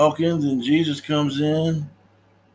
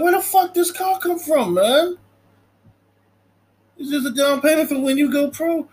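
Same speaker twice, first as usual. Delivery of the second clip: uncle's outburst; 11 semitones higher than usual. Retold or imitated. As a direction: demand answers about the car.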